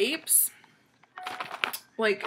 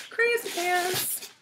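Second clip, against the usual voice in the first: slow goofy high voice; slow silly high voice; 10 semitones higher, slower than usual. Real, not acted. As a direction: play it daft. Goofy drawn-out voice.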